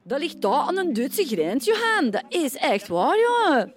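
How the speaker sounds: Kempen dialect, Johan and jongen shouted high; Kempen accent. Shown as a demonstration. Showing how people from the Kempen talk, loud broad Kempen accent. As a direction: belt out the names like a Kempen local. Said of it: "Kempen hebben we ook zo".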